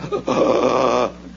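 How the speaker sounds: drawn-out mock gasp; imitated gasping for breath. Farmer pinned under a tractor shows his struggle, imitated gasping. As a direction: wheeze as if you can't get air. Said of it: "I was going like".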